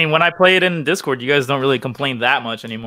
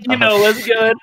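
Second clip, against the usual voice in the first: higher-pitched mock-flirty echo; teasing echo; 8 semitones higher, slower than usual. Teasing a friend about visiting Japan, mock come-on. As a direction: parrot it back in a raised, teasing voice.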